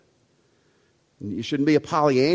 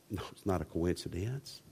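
hushed insistence; 10 semitones lower, 15 dB quieter than usual. Acted. Hushed rebuttal to own joke.

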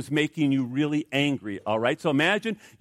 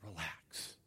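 much lower, far softer calming murmur; soothing relax; 11 semitones lower, 23 dB quieter than usual. Shown as a demonstration. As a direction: breathe the word out to calm yourself. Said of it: "you just start saying".

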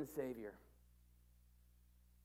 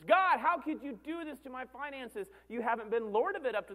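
high, loud protest to heaven; mock outraged complaint; 6 semitones higher, 5 dB louder than usual. Believer blaming God over finances, high mock outrage. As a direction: protest loudly, pitched up.

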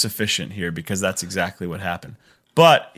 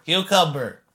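"Cucumber" said in a higher put-on kid voice; playful kid; 7 semitones higher than usual. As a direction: say "Cucumber" like a trusting child at the dinner table. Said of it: "look up at the ceiling and go".

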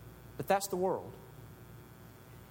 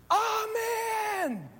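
jubilant amen; 5 dB louder than usual. Acted. Living creatures answer the worship.

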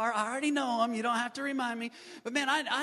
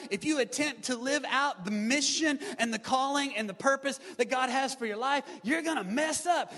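higher-pitched preacher's cadence, phrase upon phrase; rousing preaching; 5 semitones higher, 3 dB louder than usual. Real, not acted. Preaches a building refrain.

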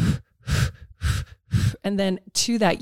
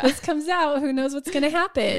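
a hammed-up frightened plea, pitched up; mock panicky body voice; 7 semitones higher than usual. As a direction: playfully voice a scared inner voice pleading. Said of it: "Because our body's like".

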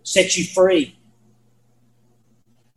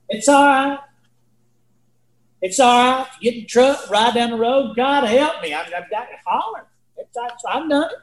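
higher-pitched acted plea; self-soothing plea; 6 semitones higher than usual. Man praying as he drives down the road, mildly acted high plea. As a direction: talk yourself through it, pitched up.

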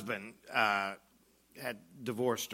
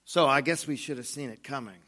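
normal talking voice

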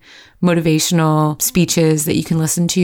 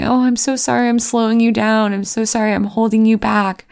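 higher-pitched, pleading retold apology; apologetic; 5 semitones higher than usual. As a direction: apologize guiltily to a husband for holding him up. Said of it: "I would apologize to my husband".

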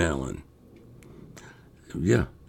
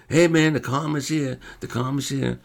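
higher, louder urgent call; excited heads-up; 9 semitones higher, 3 dB louder than usual. Retold or imitated. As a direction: call it out, imitating an excited buddy. Barry urgently tells him something, acted.

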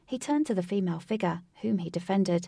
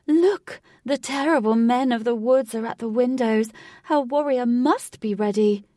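eccentric showman voice; 5 semitones higher, 7 dB louder than usual. Acted. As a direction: ham it up like a game show host. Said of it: "in his eccentric voice".

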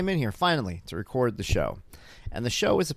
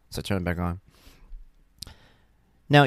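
usual speaking voice

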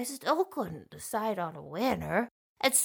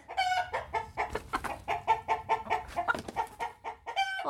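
chicken cluck sound; 11 semitones higher, 8 dB quieter than usual. Pretend.

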